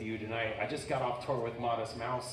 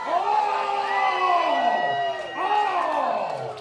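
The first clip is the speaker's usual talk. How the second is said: shouted high exclamation, much higher and louder; 13 dB louder than usual. Real, not acted. Host yelping on stage after bending over, shouted. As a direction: yelp it out.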